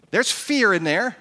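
normal talking voice

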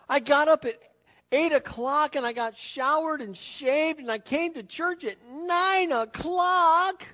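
high, far-off mock voice of a complacent churchgoer; whiny churchgoer. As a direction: play the lazy believer asking if it's enough.

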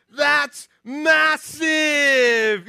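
imitating a thrilled British three-year-old; amazed little boy. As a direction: stretch each word wide in mock childlike awe. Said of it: "words that come out of his mouth".

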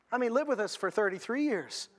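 usual speaking voice

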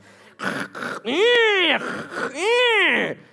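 loud high burst of laughter, falling away; big laugh. Real, not acted. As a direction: let a real laugh break out, loud and high. Laughs at own stick-shift joke.

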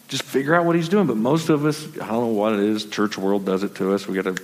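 normal talking voice

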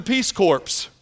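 "Peace Corps" with "Corps" said like "corpse", the p and s sounded. Retold or imitated. Nervous kid in speech class says "Corps" like "corpse". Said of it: "He's about to be a corpse".

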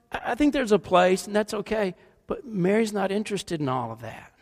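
ordinary talk, nothing marked

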